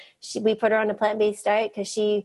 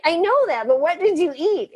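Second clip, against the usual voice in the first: high-pitched, insistent retold questioning; imitating an eager cross-examination; playful grilling; 8 semitones higher than usual. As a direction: push past the answer, mock impatient. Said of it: "I just started grilling her".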